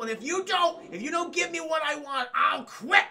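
angry threat. Retold or imitated. Daffy threatening the boardroom, rising angry shout. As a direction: push it up into an ultimatum.

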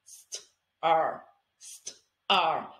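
"St- R" split into slow separate sounds; sounding out. Shown as a demonstration. Teacher modelling how a child sounds out st and R. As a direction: break "st-" and "R" apart like a child blending. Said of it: "Tell me what you get".

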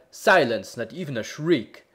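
loud, sharp call for silence; a loud commanding cry; bellman's cry. A narrator's reading. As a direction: bark the order for silence. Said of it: "And the bellman cried".

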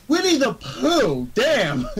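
high, drawn-out shock; astonished reaction. Retold or imitated. Fan reacting to crude Winnie the Pooh, astonished. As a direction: stretch the name out high in amazement. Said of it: "so I'm like".

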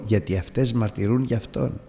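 usual speaking voice

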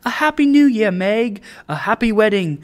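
high, loud celebratory shouting; screamed good wishes. A narrator's reading. Neighbors bursting in on the bride, shouted cheer. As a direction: cheer it out like a jubilant crowd. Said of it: "a flock of neighbors screaming".

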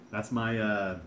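ordinary talk

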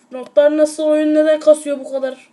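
sudden loud outburst at the lag; frustrated outburst. Real, not acted. Gamer yelling at his lagging phone game, loud and fed up. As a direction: burst out at the stuttering game.

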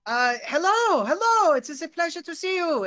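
put-on high, gushing mixed German-French accent; excitable newcomer with a German-French accent. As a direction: greet eagerly in a hammed-up Franco-German accent. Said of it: "he's switching between German and French".

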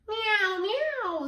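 high, falling meows; two high cat calls. A narrator's reading. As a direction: mew like a storybook cat. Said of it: "says Cat".

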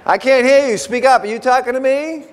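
high, mock-indignant "you talking to me"; mock confrontational challenge. Pastor acting out a heated discussion, mock challenge. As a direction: play someone daring a mutterer to speak up. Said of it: "you're like".

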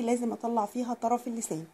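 ordinary delivery

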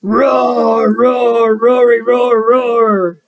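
loud, drawn-out sing-out roaring; playful monster roar. Acted. Reader plays the monster for children, loud playful roars.

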